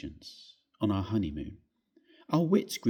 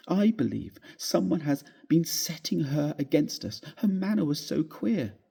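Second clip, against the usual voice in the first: high, fretful wife's voice; upset young wife; 7 semitones higher than usual. Acted. Wife tells her husband the servant is leaving, upset. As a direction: pour out the worry, pitched high and tearful.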